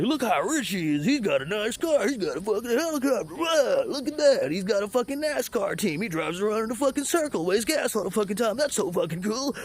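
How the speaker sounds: high, gushing starstruck fan voice; mocking dazzled fanboy. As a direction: gush like an awed fanboy over a rich man's toys. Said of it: "You're like".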